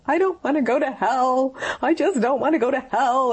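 reliving a desperate cry without full sobs; retold sobbing plea. Wife sobbing in the bathroom to her husband, mildly tearful pleading. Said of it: "I'm sobbing".

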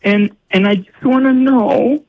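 high, pleading exasperated mother; frazzled mom confessing. Retold or imitated. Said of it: "Go to confession".

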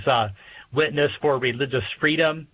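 ordinary delivery

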